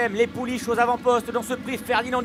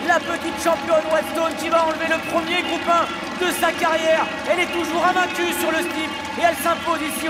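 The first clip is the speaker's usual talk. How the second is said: excited race call; 5 semitones higher than usual. Real, not acted. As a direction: hail the winning filly, voice soaring. Winner crosses line, race climax.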